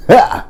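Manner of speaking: one high loud scornful "Ha!"; loud high burst of mock laughter; sharp mocking laugh. Real, not acted. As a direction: bark a single scornful laugh.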